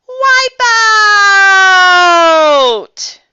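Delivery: high, loud, drawn-out cry falling away; surfer's yell. Acted. Froggy surfing on dad's shoulders, wipeout yell. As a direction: holler it and stretch the last word.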